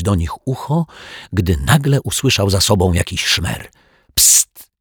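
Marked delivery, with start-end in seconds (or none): 4.1-4.7 soft unvoiced psst; quiet hiss. A narrator's reading. Kids hiding under stairs call the detective, hissed psst. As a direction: hiss to get his attention. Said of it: "syknął ktoś cichutko".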